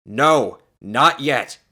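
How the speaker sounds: loud, emphatic refusal; firm refusal. A narrator's reading. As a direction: hold her off firmly. Doctor firmly refusing her demand.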